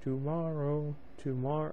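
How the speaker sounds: plain reading voice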